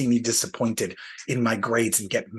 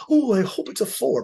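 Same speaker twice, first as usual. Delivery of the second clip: higher, louder hammed-up hoping; mock hopeful self-talk; 8 semitones higher, 5 dB louder than usual. Collector mocking his own hopeful lie, hammed-up. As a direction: play a collector fooling himself, eager. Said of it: "you lie to yourself to talk yourself down".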